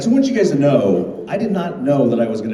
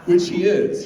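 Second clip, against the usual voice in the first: ordinary talk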